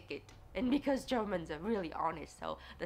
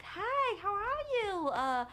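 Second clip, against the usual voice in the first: much higher, louder, bubbly American greeting; hammed-up friendly American chatter; chirpy American; 13 semitones higher, 6 dB louder than usual. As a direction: play an over-friendly American tourist. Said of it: "they were like".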